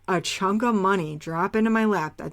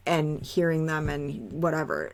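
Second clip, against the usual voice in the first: ordinary talk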